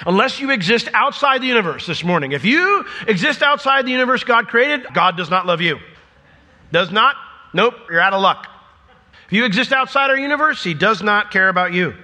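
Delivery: high, punchy, tongue-in-cheek declaration; mock blunt rejection. Preacher jokingly excluding anyone outside the universe, mock-emphatic. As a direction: dismiss them loudly and absurdly, hammering each phrase.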